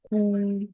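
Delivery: "pou" high, long and level; sustained, unbending high "pou"; first tone. Shown as a demonstration. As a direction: hold "pou" on one high note. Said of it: "le ton constant, le premier ton".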